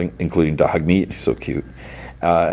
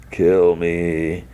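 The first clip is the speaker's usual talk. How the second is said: drawn-out mock groan; distorted voice. Severed head keeps begging Lucy, lightly distorted voice. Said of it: "in a distorted voice".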